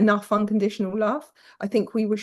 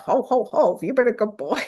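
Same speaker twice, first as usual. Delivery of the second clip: playing Santa. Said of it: "I would play Santa".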